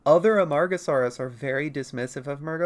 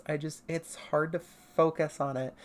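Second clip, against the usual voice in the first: normal talking voice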